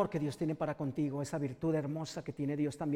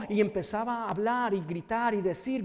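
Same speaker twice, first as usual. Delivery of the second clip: preaching at full force; 3 semitones higher than usual. Acted. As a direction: build the sermon to a fervent climax. Preaching continues.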